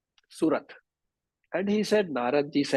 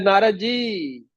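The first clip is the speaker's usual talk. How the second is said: drawn-out, high-pitched anxious call; frightened cry; 7 semitones higher, slower than usual. Acted. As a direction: call out slowly for help, acting scared. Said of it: "He became very frightened".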